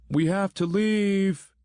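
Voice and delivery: high, slow, stretched call across a distance; yelling from afar. Acted. Grant yells from the van to his friend in the woods, high and stretched. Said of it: "Grant yelled from the van".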